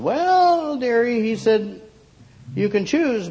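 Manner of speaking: put-on high old shopkeeper voice; a kindly old shopkeeper drawling dearie. A narrator's reading. Shopkeeper calls her dearie.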